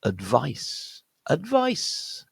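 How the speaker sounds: "advice" as ud-VICE, drawn-out ending; weak schwa, then stress VICE in "advice"; second-syllable stress. Shown as a demonstration. Teacher models the noun, "advice" stressed on second syllable. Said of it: "stress point is on the second syllable".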